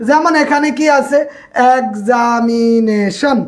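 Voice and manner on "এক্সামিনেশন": "এক্সামিনেশন" stretched very slowly, syllable by syllable; drawn-out syllables. Shown as a demonstration. Teacher showing a polysyllable example, "এক্সামিনেশন" slowly stretched out. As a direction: draw out each vowel of "এক্সামিনেশন" without breaking it. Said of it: "তিনের অধিক বারে উচ্চারণ".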